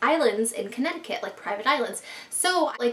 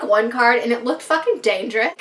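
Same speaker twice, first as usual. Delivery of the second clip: normal talking voice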